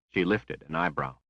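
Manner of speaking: ordinary delivery, nothing marked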